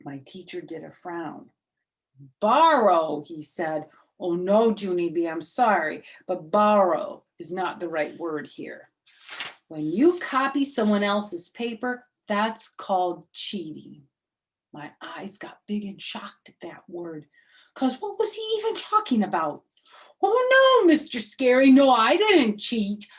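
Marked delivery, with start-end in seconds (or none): 2.4-3.2 a louder, firm teacher repeating the word; stern teacher voice. Acted. Mister Scary correcting Junie B, firm and loud. 4.2-14.1 louder, firm but kindly teacher; lightly voiced teacher. Mister Scary explaining cheating to Junie B, firm. 17.7-23.1 put-on much higher, louder little girl voice; little girl protesting. Junie B protesting to Mister Scary, high and loud.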